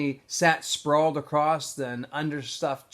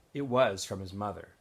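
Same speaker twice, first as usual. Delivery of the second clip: ordinary delivery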